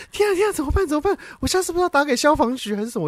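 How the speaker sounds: high, fast panicky babble; retold panic. Recalling the snake attack to a friend, high panicky babble. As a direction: rush it out like you're losing it.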